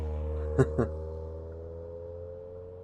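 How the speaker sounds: soft, low knowing laugh; dark little chuckle. Acted. Dark stranger amused by legends seeping in.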